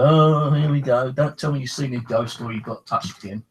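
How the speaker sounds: mock the sister's eye-rolling here we go; sister's weary voice. Sister teasing Stephen about ghosts, weary mock tone. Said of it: "She go".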